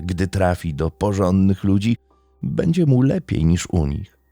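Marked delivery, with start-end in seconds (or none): none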